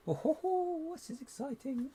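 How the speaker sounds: high gleeful squeal; excited. Real, not acted. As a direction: squeal with glee.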